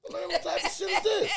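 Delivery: high-pitched comic alarm; hammed-up scared squeal; mock panic. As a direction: act out frightened disbelief, voice way up. Acts out fear of getting shot.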